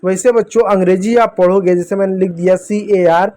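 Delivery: ordinary talk, nothing marked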